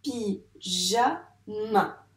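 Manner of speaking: "Pyjama" split pi... ja... ma with pauses; teacher separating pi, ja, ma; syllable by syllable. Shown as a demonstration. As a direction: count out "Pyjama" in three slow beats. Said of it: "je dis chaque syllabe".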